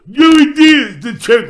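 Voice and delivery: high-pitched, very loud mock holler; kid yelling. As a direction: imitate an excited kid shouting out a name. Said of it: "there's a kid going".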